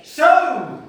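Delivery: loud, high jeering; scornful, humiliating heckle; mocking sarcasm. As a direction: hurl it like a taunting passer-by. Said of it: "That's sarcasm. That's humiliation. That's mocking and insults".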